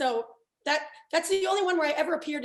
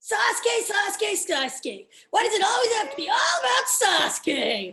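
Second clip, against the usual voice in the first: loud, higher, exasperated yelling; frustrated Naruto shout; 7 semitones higher, 4 dB louder than usual. Acted. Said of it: "piece of dialogue that really resonated".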